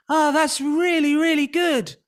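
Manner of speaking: put-on childlike voice. Shown as a demonstration. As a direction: sound like a little kid. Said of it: "the childlike sound".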